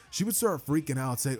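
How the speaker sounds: ordinary delivery, nothing marked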